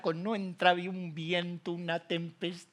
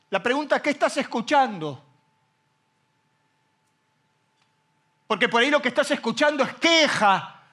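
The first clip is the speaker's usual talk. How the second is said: full-force preaching; 10 semitones higher, 9 dB louder than usual. Acted. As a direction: rouse the congregation. (predicación a toda voz)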